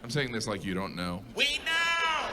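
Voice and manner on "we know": exasperated shout. Acted. Comic telling Canadians off, exasperated high shout. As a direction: yell it, fed up.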